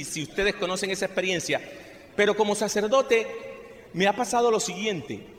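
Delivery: ordinary delivery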